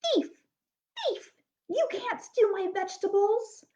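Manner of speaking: lightly voiced scolding witch. A narrator's reading. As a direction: accuse like a witch. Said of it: "the witch said".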